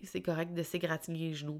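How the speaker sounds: ordinary talk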